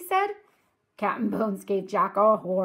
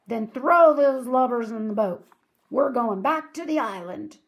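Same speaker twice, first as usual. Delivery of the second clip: loud, higher pirate orders; roar; 4 semitones higher, 7 dB louder than usual. Acted. Captain Bones sends his men to the boat, roaring. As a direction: keep bellowing orders at the crew. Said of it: "he roared at his men".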